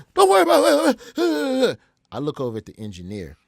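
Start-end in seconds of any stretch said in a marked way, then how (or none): 0.1-1.8 high, loud mock yell; going off on him. Prank caller refusing to say where he is, going off. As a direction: holler it back defiantly. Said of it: "I'm just going off".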